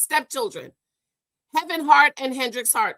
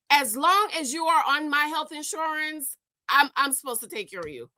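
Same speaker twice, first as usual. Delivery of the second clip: ordinary talk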